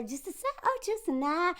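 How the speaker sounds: high, soft Southern belle flutter. Acted. Vlogger mimicking a TV character's whole Southern belle act, high and soft. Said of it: "the whole, like, Southern belle".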